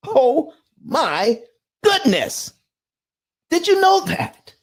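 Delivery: high, thrilled outburst; excited. Real, not acted. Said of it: "I get excited every time I talk about this".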